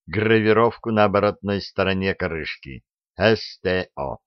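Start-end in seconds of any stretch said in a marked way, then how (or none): none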